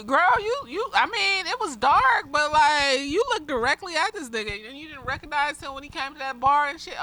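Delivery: high-pitched, incredulous chiding; mock disbelief. Viewer asking the heroine how she missed him, incredulous.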